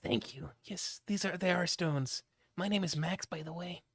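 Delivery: breathy offhand thanks, barely voiced; soft Max voice. Max casually thanks the helper carrying his stones, soft whisper.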